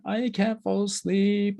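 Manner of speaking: put-on high, slow whiny child voice; imitating a crying child who can't sleep. Daughter pleading with her parents at bedtime, high and whiny. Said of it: "and she's crying".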